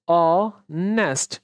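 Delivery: "অনেস্ট" split slowly as aw-nest; slow, silent h. Shown as a demonstration. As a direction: draw out the opening vowel of "অনেস্ট". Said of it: "আমরা বলি এটাকে বলি অনেস্ট".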